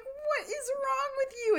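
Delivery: high, squealed exasperation; hammed-up indignant squeal; mock outrage. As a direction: imitate an appalled girlfriend, pitch way up. Retold outrage at drink in face.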